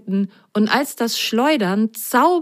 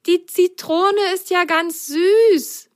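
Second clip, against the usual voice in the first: high, slow amazed delight; high slow enchanted surprise; 13 semitones higher, slower than usual. Acted. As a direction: marvel at the sweetness. Delighted by sweet lemon.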